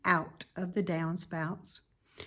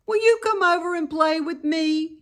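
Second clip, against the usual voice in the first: put-on high, louder young pig voice; pleading piglet; 9 semitones higher, 6 dB louder than usual. A narrator's reading. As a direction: ask hopefully like a lonely little pig. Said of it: "he asked".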